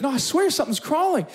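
put-on high-pitched little-boy protest; acted child's pleading; imitated insistent child. Acts his scared five-year-old son.

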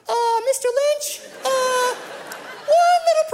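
timid mouse voice to a famous athlete; tiny nervous voice. Retold or imitated. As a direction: squeak it out like a scared kid. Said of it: "that's how I felt talking to him".

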